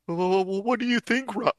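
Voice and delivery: very high falsetto character voice; high-pitched hooting lead-in, then the question; Brook. Acted. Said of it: "Brook talking".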